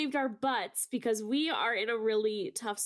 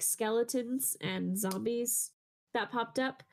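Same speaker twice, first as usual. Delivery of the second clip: usual speaking voice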